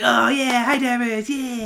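Cartman voice. Retold or imitated. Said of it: "It's like".

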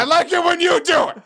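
high, loud yell; shouted demand. Acted. Applicant insisting the interviewer make the coffee, shouted. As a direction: push it up into a mock-angry shout.

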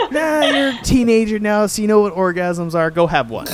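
high, loud hammed-up director advice; a high-pitched mock director, teasing. Podcaster voicing the director talking to Maisie, high and loud. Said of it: "he's like".

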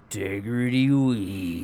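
slow punchy Southern commercial announcer; drawn-out ad voice selling weed; hard sell ad. Retold or imitated. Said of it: "the commercial voice".

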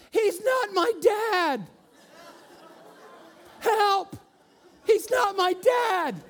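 put-on very high, loud, slow kid scream; hammed-up toddler yelling he's not my dad; child screaming for help. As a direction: shriek it out like a little girl faking danger. Daughter yells in Target.

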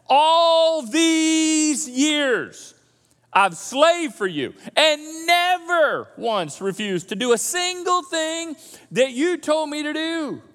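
loud, slow, wounded protest; older son protesting to his father, aggrieved; aggrieved complaint. Acted. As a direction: plead your grievance, voice cracking. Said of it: "You get the tone here?".

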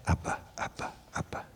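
whispered echo. Retold or imitated. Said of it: "Little boy".

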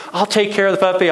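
put-on high, loud eager kid voice; begging child. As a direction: plead like a little boy desperate for a puppy. Boy begging mom for puppy.

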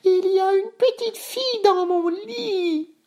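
put-on slow, extremely high baby voice; squealing baby bear. A narrator's reading. Bébé Ours discovering a girl in bed, high squeal. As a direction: squeal it, stretching the last word. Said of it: "dit Bébé Ours".

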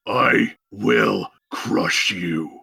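slow, low brute threat; barbarian. Acted.

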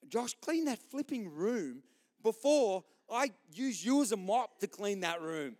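hammed-up stern order to tidy up; mock harsh dad. Dad imitating himself scolding Josh over his room, slow mock anger. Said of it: "sometimes I can be harsh and like".

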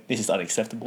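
mock stern, fighting laughter. Complaining to the waiter, mock stern. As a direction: try to keep a straight face. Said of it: "trying to give a straight face".

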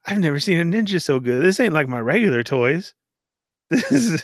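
high mock-awed kid voice; gleeful boy. Imagined boy comparing ninjas to his toys, gleeful. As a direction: marvel like a boy with a windfall.

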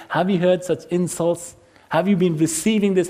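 ordinary talk, nothing marked

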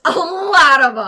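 loud boast. Acted. Player boasts loudly.

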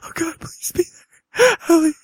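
very high sobbing plea; scared, in pain. Acted. Abducted man begging Allison to save him, sobbing in terror. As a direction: beg through sobs, voice breaking up into gasping cries. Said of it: "You're in pain".

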